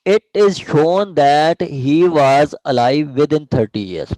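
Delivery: reading the law out slowly, word by word; slow dictation. Shown as a demonstration. Teacher reading the section slowly to students, dictation pace. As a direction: dictate each phrase slowly for the class to follow. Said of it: "teacher reads section slowly aloud".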